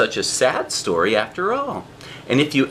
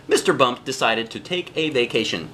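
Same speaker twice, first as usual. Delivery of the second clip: ordinary delivery, nothing marked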